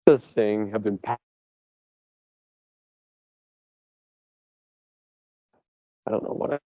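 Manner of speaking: choppy audio dropping out; bad connection. Real, not acted. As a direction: voice breaking up online. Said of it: "you're, uh, cutting out a bit".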